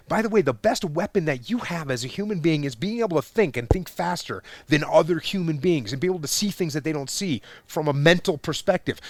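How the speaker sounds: normal talking voice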